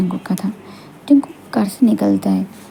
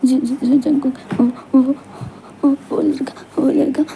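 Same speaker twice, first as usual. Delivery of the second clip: high, sobbing broken words; tearful stammering; 3 semitones higher, 3 dB quieter than usual. Acted. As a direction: struggle to get words out through tears. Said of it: "ये रोना धोना बंद करो". (रोते हुए हकलाकर)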